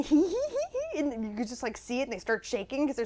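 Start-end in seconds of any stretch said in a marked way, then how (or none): 0.0-1.0 imitated kid giggle.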